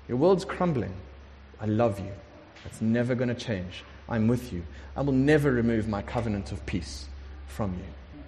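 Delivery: low, softened, steady voice of comfort; tender reassurance. Acted. God answering someone whose world is crumbling, calmly reassuring.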